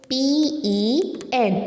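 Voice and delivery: slow letter-by-letter spelling. Shown as a demonstration. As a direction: draw out each letter for pupils. Spelling out a word for pupils.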